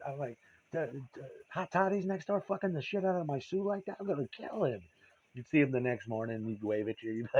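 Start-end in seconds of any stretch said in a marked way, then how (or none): 0.7-4.8 much higher, stammering outburst; retelling his fury at the neighbour, pitched high; jealous outrage. Retold or imitated. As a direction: sputter with jealous disbelief.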